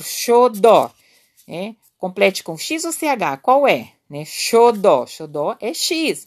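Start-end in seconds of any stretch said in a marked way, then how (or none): 0.0-0.9 "xodó" loud, in two clear syllables, sho-DÓ; example word. Shown as a demonstration. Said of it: "Por exemplo". 4.2-5.0 "Xodó" loud and deliberate, opening on sh; example word. Shown as a demonstration. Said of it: "tem um som de C H".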